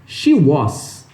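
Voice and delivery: SHE WAS as "shi u-ÓSS"; learner's SHE WAS, ending like "boss"; Brazilian mispronunciation. Retold or imitated. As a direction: open O and hissed S on WAS. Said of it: "E aí você fala".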